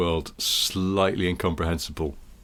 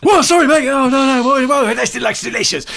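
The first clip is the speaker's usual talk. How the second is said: high, loud amazed yelling; hammed-up excitable presenter bellow; hyperactive shouting; 7 dB louder than usual. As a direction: shout in over-the-top disbelief. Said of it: "shouting at people".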